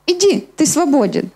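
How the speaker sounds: ordinary talk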